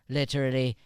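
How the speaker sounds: ordinary talk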